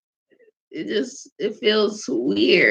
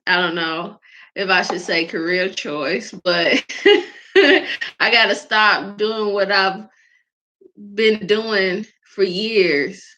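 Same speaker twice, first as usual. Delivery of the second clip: usual speaking voice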